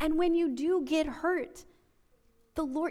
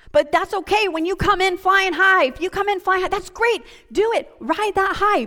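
louder and higher, egging the crowd on; fired-up urging; 7 semitones higher, 6 dB louder than usual. Real, not acted. Preacher urging new believers to ride the high, fired-up and loud. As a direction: ride the high with them, full of fire.

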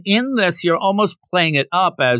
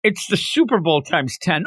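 normal talking voice

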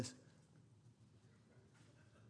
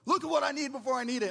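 higher, louder, quick fatherly command; dad's favorite saying; 6 semitones higher, 4 dB louder, much faster than usual. Retold or imitated. Father repeating his favorite quote, raised and fast. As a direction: rattle it off like dad's motto. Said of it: "That was his favorite quote".

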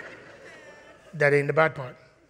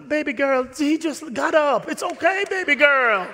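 mock soothing; 9 semitones higher, 4 dB louder than usual. Referee soothing mom.

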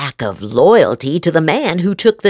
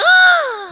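sharp high gasp. Acted. Gasping at the Times' dishonesty. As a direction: gasp in mock shock.

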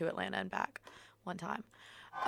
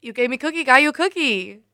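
louder and higher; louder, higher repeat; 6 semitones higher, 6 dB louder than usual. Shown as a demonstration. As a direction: say it again louder, pitched up.

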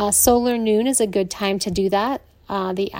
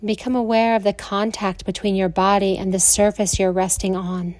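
soothing Yoga Nidra guidance with long pauses; guided meditation. Acted.

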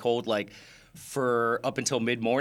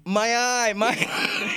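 mock scream, higher pitched, breaking into laughter; retold scream; 8 semitones higher, slower than usual. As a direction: imitate a pained cry while laughing. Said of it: "and Fran screaming".

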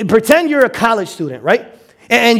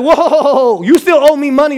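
1 mock university laughing; 5 semitones higher than usual. University mocked demanding money back.

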